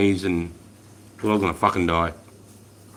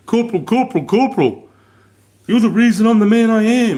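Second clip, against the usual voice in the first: high, loud excited calling of corporal; recruit calling out; 11 semitones higher, 7 dB louder than usual. Retold or imitated. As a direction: call out to your old corporal, thrilled. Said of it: "And I just hear".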